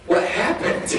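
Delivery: put-on high little-kid question; mock small boy, confused; bewildered young son. Voicing son's shocked question.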